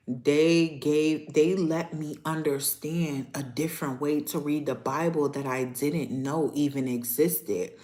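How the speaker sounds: ordinary talk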